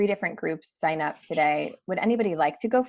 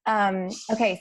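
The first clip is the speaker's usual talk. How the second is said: ordinary delivery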